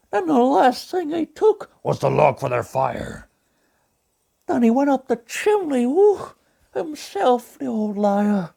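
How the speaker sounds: gentle cartoon bear narrating; Pooh Bear voice. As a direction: read it as a gentle honey-loving bear. Pooh Bear reads the story.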